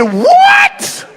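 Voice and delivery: a speaker realizing the song was Confederate, shocked yelp; shocked disbelief. Real, not acted. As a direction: yelp it like you can't believe it.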